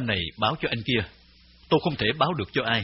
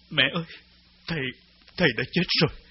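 tearful; 2 semitones higher, much faster than usual. A narrator's reading. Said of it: "nói trong nước mắt".

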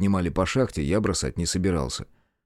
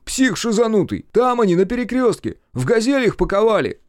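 high, loud, flustered answer; agitated high-pitched sidekick; indignant outburst; 13 semitones higher, 5 dB louder than usual. A narrator's reading. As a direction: shout back at the madman who grabbed you. Partner angrily answers after being grabbed.

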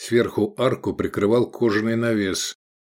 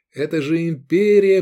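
higher, louder, eager assertion; enthusiastic; 8 semitones higher, 5 dB louder than usual. A narrator's reading. Gladiator inspiring his comrades, enthusiastic. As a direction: urge it with zeal. Dreamer urging comrades about empire.

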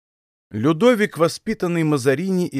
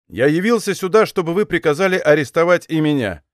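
usual speaking voice

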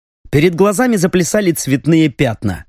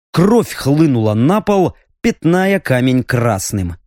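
ordinary delivery, nothing marked

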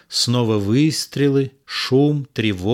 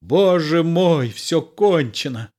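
mild cry out; 4 semitones higher than usual. A narrator's reading. Cyrano crying out in despair, releasing Roxane's hand, mild cry. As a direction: let it break out of him as a cry. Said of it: "с криком".

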